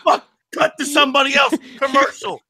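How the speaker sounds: high, fast, flustered TV producer; a producer freaking out as Diaz lights up; panicked producer. Retold or imitated. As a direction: play the frantic guy at Fox. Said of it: "Then he's like".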